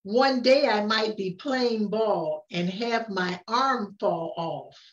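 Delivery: plain reading voice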